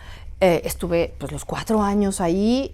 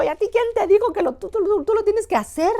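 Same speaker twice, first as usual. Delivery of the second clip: incredulous director; 12 semitones higher than usual. Retold or imitated. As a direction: fire the question back high and fast. Director scolding, very high and loud.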